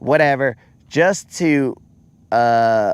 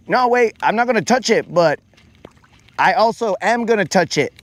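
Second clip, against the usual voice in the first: higher, protesting brother voice; imitating his brother; 5 semitones higher than usual. Brother insisting he won't touch it, high mock protest. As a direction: pitch it up, playing the sidekick who won't listen. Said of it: "my brother will be there and he'd be like".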